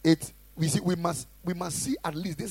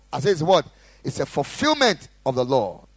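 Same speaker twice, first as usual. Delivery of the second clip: full-force preaching; 16 dB louder than usual. Acted. Pastor exhorting the congregation, loud fervent preaching. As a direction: preach it hard to the room.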